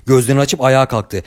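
plain reading voice

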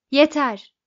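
angry. A narrator's reading. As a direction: cut them off angrily. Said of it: "dedi öfkeyle".

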